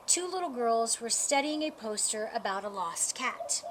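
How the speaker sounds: plain reading voice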